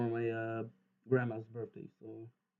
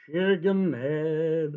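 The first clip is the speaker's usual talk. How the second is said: slow, loud mocking insult; drawn-out taunt; 3 semitones higher, 13 dB louder, much slower than usual. Real, not acted. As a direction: stretch it out to mock him. Taunting the game character.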